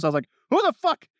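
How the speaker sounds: normal talking voice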